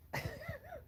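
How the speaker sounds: very high mock cackle, imitating a friend; imitated shrill laugh. Mocking a castmate's laugh with a shrill falsetto cackle. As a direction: squeal out a tiny falsetto laugh. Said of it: "and then his laugh".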